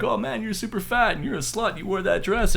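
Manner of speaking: high, taunting comedian roast; lightly voiced roast comic; mocking comic voice. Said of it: "he's like".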